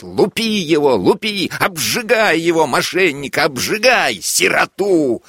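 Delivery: high, gloating mock yells; gleeful shouting. A narrator's reading. Officer laughing and urging soldiers on, high gleeful shouting. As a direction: cackle out the orders between laughs. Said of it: "и слышится опять".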